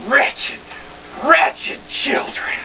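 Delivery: slow, hoarse whispered despair; a mother seeing the damage, appalled; dismayed mother. Acted.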